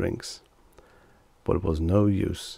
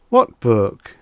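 innocently; 3 semitones higher, 8 dB louder than usual. A narrator's reading. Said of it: "Reggie asked innocently".

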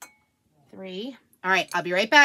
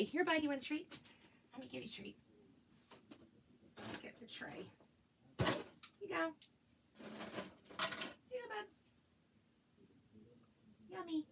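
soft pet talk; 5 semitones higher, 14 dB quieter than usual. Real, not acted. Mom quietly cooing while feeding her dog a treat.

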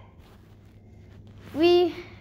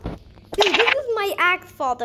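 normal talking voice

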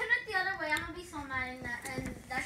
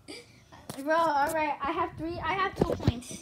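ordinary talk

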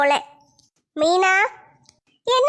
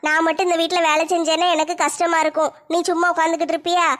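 ordinary talk

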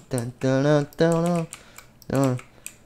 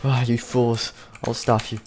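low, softer sneering villain; 7 semitones lower, 5 dB quieter than usual. Acted. Speaker's character threatening the fools, low and sneering. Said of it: "if I was like".

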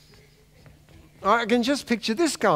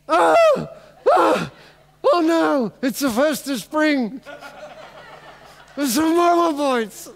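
dismayed; 6 semitones higher, slower than usual. Acted. Dead man realizes the army comes.

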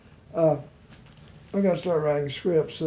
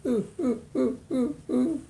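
high falsetto cackle; 8 semitones higher than usual. Acted. Dummy laughs at the joke.

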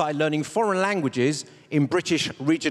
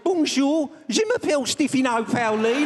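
French in a higher Brummie accent; 6 semitones higher, 3 dB louder than usual. Shown as a demonstration. Comic speaks French with a Brummie accent. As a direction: Birmingham whine on the French. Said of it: "French with a Brummie accent".

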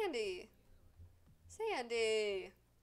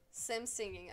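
ordinary delivery

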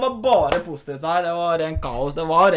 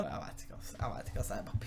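whisper; 9 semitones lower, 16 dB quieter than usual. Real, not acted. Muttered resigned aside.

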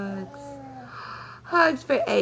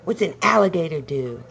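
usual speaking voice

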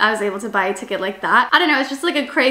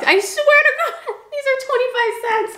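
high, laughing disbelief; amazed; 13 semitones higher than usual. Real, not acted. Vlogger marvels at cheap water, high and laughing. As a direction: swear to it, still amazed and giggling.

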